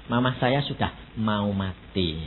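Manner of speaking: low, quiet, slow plea; soft slow words of someone losing their mother; grieving plea. Retold or imitated. Voicing a grieving child. (suara lirih pelan orang berduka)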